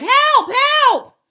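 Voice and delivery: panicked screams for help; crying for help. A narrator's reading. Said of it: "he cried".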